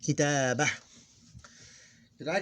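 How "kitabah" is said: "Kitabah" with long "ta" and final breathed h; vowel-less ending. Shown as a demonstration. Teacher repeating the pausal word for learners, vowel-less ending. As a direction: end "Kitabah" on h, no vowel after it. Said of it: "we ignore the vowel".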